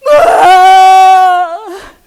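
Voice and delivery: high, loud cry of shock and dread; loud, high scream of despair; anguished scream. Retold or imitated. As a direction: wail out at the bad news. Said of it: "And I just went".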